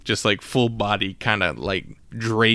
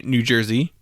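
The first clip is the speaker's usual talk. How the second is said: ordinary delivery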